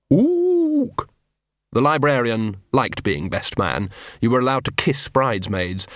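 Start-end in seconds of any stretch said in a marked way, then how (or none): 0.1-1.1 ape's shrill eager hoot, pitched far up; very high ook. Acted. Ignored librarian ape pleads with Vimes, hooting very high. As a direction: hoot high, begging to be chosen.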